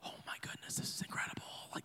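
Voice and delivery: breathless whisper of wonder; awed whisper. Retold or imitated. Pastor whispering in awe on first entering the new land. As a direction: whisper it like you just walked into the park. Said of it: "I'm like, gasps".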